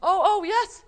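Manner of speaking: loud, high, delighted hello; excited greeting. Acted. Teacher greets Mister Bones in front of children, excited. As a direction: greet a forgotten friend with big enthusiasm. Greets puppet who speaks up.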